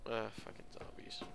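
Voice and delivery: hushed whisper near danger. Real, not acted. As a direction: whisper it so they don't hear.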